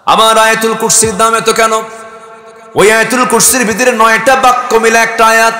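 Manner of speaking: high, loud sustained proclamation; full-force preaching. Acted. Preacher proclaiming God's creation to the crowd, high and loud. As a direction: raise the voice and proclaim it.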